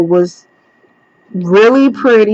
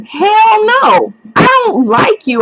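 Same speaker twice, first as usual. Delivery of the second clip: indignant retort; 13 semitones higher than usual. Retold or imitated. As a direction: fire it back at him, way up high. Retold angry rejection of bully.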